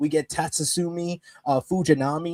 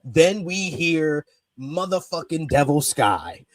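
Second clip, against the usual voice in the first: ordinary delivery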